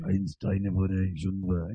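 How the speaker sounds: slow, low casual German; casual German accent. Shown as a demonstration. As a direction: drop the pitch and speak German calmly. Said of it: "if you're talking casual".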